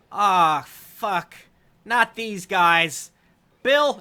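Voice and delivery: loud, high undead minion in dismay; high, hollered ghoul complaint; exasperated ghoul. Acted. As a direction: groan loudly at unwelcome visitors, pitch climbing.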